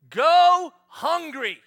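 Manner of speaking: loud, high, slow final verdict; mock dad outburst. Dad to picky kids, loud slow mock verdict. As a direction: drop it like a door slamming. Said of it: "I feel like taking that plate".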